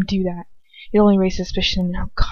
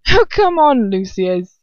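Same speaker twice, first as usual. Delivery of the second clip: thick slurring man; 3 semitones higher, 4 dB louder, slower than usual. A narrator's reading. Stranger protesting to Lucius, lightly slurred man's voice. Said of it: "slurring man's voice I don't recognize".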